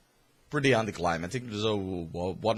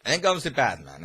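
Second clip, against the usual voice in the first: normal talking voice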